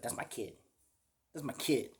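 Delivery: put-on very soft, flat "kid" as if naming livestock; mock an unfeeling parent; detached, offhand "That's my kid"; cold dismissive mimic. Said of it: "I was like".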